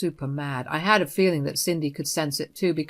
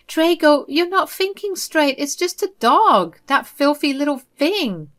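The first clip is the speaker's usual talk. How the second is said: higher, louder offended mother's voice; indignant mother; 8 semitones higher, 4 dB louder than usual. Acted. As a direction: scold him for his tone. Mother dismisses the dog.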